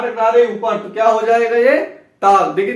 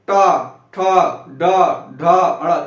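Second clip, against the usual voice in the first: टा, ठा, डा, ढा said very slowly and lower; slow syllable demo; 5 semitones lower, much slower than usual. Shown as a demonstration. Teacher asking students to feel it: slow, drawn syllables. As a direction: spell each sound out slowly to feel the tongue. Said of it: "देखिए इसको बोल के देखिए".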